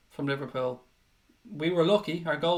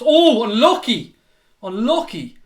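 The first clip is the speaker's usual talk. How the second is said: excited near miss; 6 semitones higher, 9 dB louder than usual. Real, not acted.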